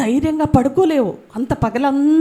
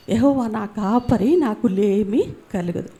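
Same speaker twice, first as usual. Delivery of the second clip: slow verse; 4 semitones lower than usual. Shown as a demonstration. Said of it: "verse recited after story".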